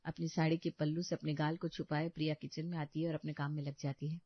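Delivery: ordinary talk, nothing marked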